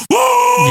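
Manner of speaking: very high held "woo"; imitated pose whoop. Demonstrating the wrestler's pose for no reason, high whoop. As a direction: let out a high-pitched whoop. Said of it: "You know, like the".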